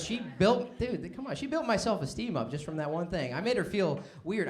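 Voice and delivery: ordinary talk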